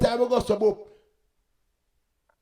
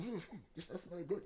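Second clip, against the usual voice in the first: soft, disgusted sniffing complaint; quiet disgusted voice; 2 semitones lower, 17 dB quieter than usual. Retold or imitated. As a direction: murmur it, put off by a bad smell. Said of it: "And I say".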